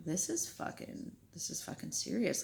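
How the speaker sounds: softly retold dread, under the breath; hushed alarm. Alarmed realisation during armed robbery, retold.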